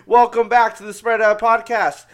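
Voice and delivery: loud, punchy podcast welcome; energetic intro. Shown as a demonstration. Host redoing the podcast intro, loud and energetic. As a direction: push the welcome out loud at a brisker clip. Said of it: "I've recorded this intro".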